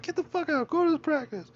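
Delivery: very high mock screech; shrill it out like a woman throwing him out; furious Karen. Karen kicking him out to practice, very high mock screech. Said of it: "she was just like".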